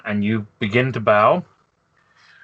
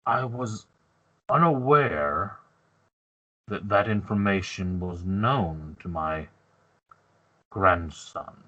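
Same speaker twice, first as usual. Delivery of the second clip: slow, measured elder, sinking lower; confused grandfather; 3 semitones lower, 4 dB quieter, slower than usual. Acted. Grandfather learns what his grandson knew, slowly confused. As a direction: puzzle it out slowly and gravely. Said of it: "looks at you very confused".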